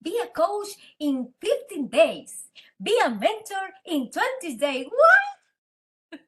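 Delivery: high, loud, astonished "what?"; incredulous disbelief. Retold or imitated. Coach scoffing at fifteen-day coaching courses, incredulous. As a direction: question it in drawn-out, mock-shocked disbelief.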